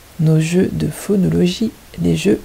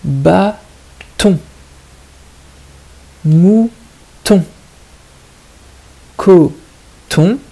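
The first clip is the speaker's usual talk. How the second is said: "Bâton", "Mouton", "Coton" in loud, separated syllables; 6 dB louder, much slower than usual. Shown as a demonstration. Teacher giving the next puzzle, words split syllable by syllable. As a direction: stretch the first syllable, pause, then land "ton". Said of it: "il faut bien écouter la deuxième syllabe".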